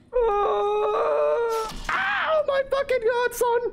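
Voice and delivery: real terror, yelled out; panicked shout. Real, not acted. A sudden dangerous enemy.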